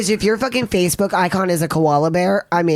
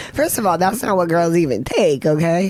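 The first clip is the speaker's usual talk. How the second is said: ordinary delivery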